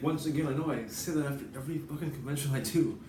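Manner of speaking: usual speaking voice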